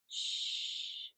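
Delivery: soft breathy hush; whispered shh. A narrator's reading. Aunt hushing the girl on the dark porch, whispered. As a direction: hush gently so the animals stay. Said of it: "Aunt Lisa whispers".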